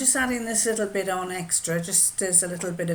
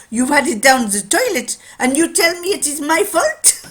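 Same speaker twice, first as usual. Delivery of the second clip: put-on loud, high, indignant French accent; 9 semitones higher, 11 dB louder than usual. Watchmaker catching out his customers, indignant French accent. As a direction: accuse them in French-accented outrage. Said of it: "He says".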